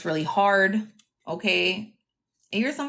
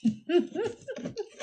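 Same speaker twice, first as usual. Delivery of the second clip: high giggle; 12 semitones higher, 4 dB quieter than usual. Real, not acted. Reader amused by her own reading, high giggling. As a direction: burst into a high, rapid giggle.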